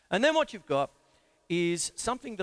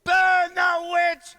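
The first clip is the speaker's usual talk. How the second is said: angry mob leader; 12 semitones higher, 12 dB louder, much slower than usual. Acted. Mob chant from clip.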